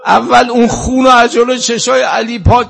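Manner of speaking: very high, loud grieving wail; full-force tearful eulogy cry; climactic wailing lament. Real, not acted. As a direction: wail the narration out at the peak of mourning.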